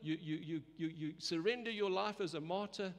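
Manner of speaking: usual speaking voice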